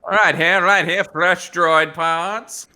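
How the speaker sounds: loud, high hawker's pitch, laughing, slowing at the end; carnival barker. Retold or imitated. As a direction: sell droids to a passing crowd. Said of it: "He's a fucking carnival barker".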